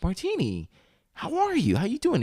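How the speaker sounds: a hammed-up friendly dad; imitating the dad. Ex's dad greeting him at the table, high cheery voice. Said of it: "he's like".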